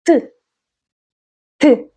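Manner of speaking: short "tuh" for T; T as a sound. Shown as a demonstration. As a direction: say T as in table, not its name. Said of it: "as in table".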